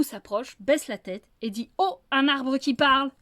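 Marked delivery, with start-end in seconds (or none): 1.8-3.1 louder, triumphant greeting; wolf proving his point to the fox; emphatic demonstration. Acted. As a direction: show him how it's done. Wolf shows the right greeting.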